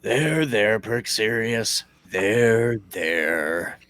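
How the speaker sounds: low, slow grudging comfort; gruff comforting. Acted. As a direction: pat the back, reluctantly. Gruff comforting of crying friend.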